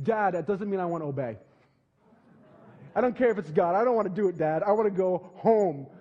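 defiant son. Retold or imitated. Son refuses to obey his father, loud defiant whine.

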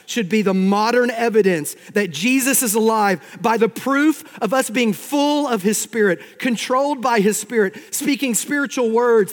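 high, loud, driving preacher's voice; full-force preaching. Acted. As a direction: build phrase on phrase like a pulpit climax.

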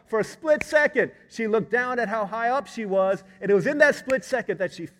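loud, high, building sermon climax; full-force preaching. Acted. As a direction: preach it with rising intensity to a peak.

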